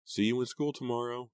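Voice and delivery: high, carrying call. A narrator's reading. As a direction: call from the doorway. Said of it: "Kimmy called from the front entryway".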